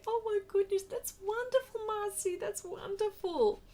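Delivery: very high, delighted squeal; thrilled. Real, not acted. Reacting to Marcy's news of a grandson, thrilled. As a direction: gush with delight over the news.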